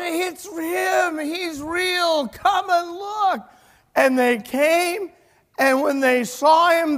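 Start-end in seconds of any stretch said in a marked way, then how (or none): 0.0-3.5 high, urgent calling out; excited insistence. Retold or imitated. Rhoda urging the doubters to the door, excited call. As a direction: call them over, stretching the last words. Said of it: "And she said".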